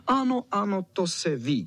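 high, mildly shouted yes; shouted agreement. Acted. All the dogs agree, calling out. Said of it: "křičeli všichni psi".

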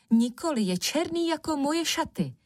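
high, argumentative storybook voice; insistent defence. A narrator's reading. Sister defending her opinion on the stone, insistent. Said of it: "hájila svůj názor Černucha".

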